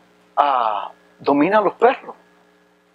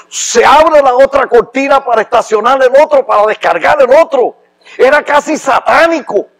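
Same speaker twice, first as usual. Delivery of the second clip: rising higher and louder, slowing on the questions; emotional rising; 6 semitones higher, 5 dB louder than usual. Real, not acted. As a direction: pour real emotion in as it builds. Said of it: "me, me, me emociono".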